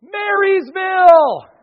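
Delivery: long, high, loud holler; shout from the back. Retold or imitated. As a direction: yell it slowly across the room. Someone in the back calls out.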